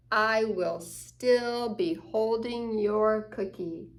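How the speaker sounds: usual speaking voice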